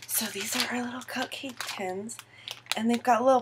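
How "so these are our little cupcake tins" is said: hushed show-and-tell whisper; whispering to the camera about cupcake tins. Real, not acted. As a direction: breathe it like a secret.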